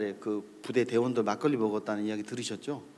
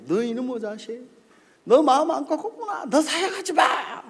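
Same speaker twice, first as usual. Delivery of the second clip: acted furious dismissal; angry pastor; 11 semitones higher, 3 dB louder than usual. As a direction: imitate a pastor blowing up at a junior. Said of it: "내가 뭐라 했는 줄".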